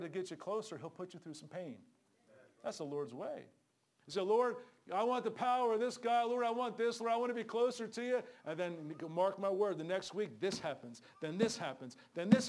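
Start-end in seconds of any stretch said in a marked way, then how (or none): 4.2-8.2 eager mock prayer.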